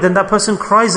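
ordinary delivery

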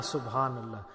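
quiet trailing Subhanallah; soft fading echo. Shown as a demonstration. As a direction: let the repeat of Subhanallah fall to a hush.

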